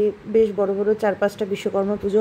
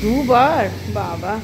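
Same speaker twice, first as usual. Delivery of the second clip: startled "twice?" in a louder voice; sudden surprise, pitched up; surprised; 3 dB louder, much slower than usual. Real, not acted. Daughter says she ate twice.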